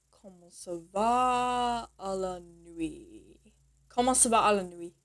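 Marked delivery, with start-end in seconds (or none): 0.1-3.4 drawn-out, soft teaching take at half speed; slow demonstration. Shown as a demonstration. Teacher showing how to say "how are you tonight", slow demonstration. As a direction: stretch "va" and "nuit" out slowly and softly. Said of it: "this is how you'll say".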